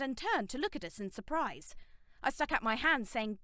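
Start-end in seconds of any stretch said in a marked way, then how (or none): none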